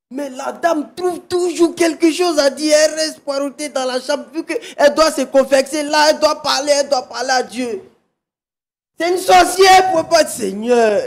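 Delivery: loud, mounting accusation; real indignant outburst. Real, not acted. Husband denounces his wife's refusal to pray.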